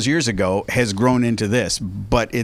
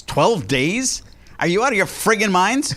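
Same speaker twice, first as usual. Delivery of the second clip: incredulous; 8 semitones higher than usual. Acted. Mock outrage, pitch jump.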